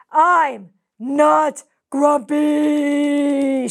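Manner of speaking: very slow, loud drawn-out yell; angry shout. Acted. Jim finally explodes at his pestering friends, loud slow yell. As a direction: bellow it out, fed up. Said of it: "shouted Jim as he beat at his chest".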